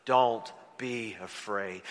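slow, low, drawn-out reading; read-along scripture. Shown as a demonstration. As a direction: read it slowly with the church. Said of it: "read with me, church".

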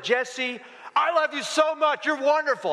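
high-pitched mock scolding; harsh tone. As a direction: scold the dog as if it misbehaved. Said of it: "Because the tone of voice".

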